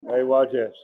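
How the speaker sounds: usual speaking voice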